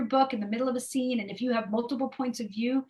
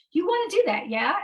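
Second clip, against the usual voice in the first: higher voice with a rising "yeah?" tag; Ari's tag "yeah"; 8 semitones higher, 5 dB louder than usual. Retold or imitated. Imitating Ari asking his partner, rising "yeah?". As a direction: ask it lightly, ending on "yeah?". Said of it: "this is how he talks".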